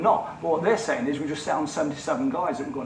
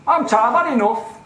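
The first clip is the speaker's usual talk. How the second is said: imitating a weary, exasperated official, higher and louder; fed up; 8 semitones higher, 8 dB louder than usual. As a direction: play him as tired and done with it, raised voice. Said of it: "this is his attitude on the phone".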